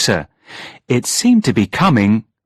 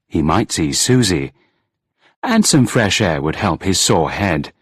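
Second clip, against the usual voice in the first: plain reading voice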